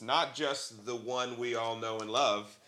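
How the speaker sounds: slow, very soft aside; hushed build-up. Acted. Co-host teasing the podcast's favorite president, slow and hushed. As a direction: drop right down, slowly, as if confiding a beloved name.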